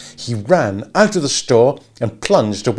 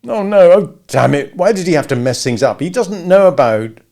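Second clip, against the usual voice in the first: anguished outburst; 8 semitones higher, 9 dB louder than usual. A narrator's reading.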